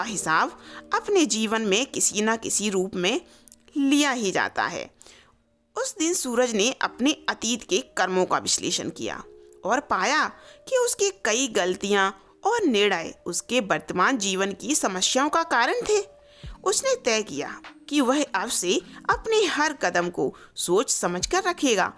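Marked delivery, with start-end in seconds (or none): none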